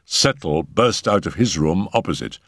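usual speaking voice

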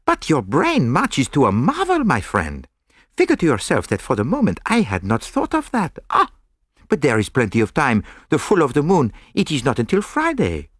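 put-on lightly French-accented, admiring detective. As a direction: praise your friend with a French lilt. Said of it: "regarding me with admiration".

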